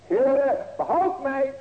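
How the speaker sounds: loud, high desperate plea; crying out for help. Retold or imitated. The preacher voices Peter calling the Lord, loud plea. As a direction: cry it out like a drowning man. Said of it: "dat is de Bijbel".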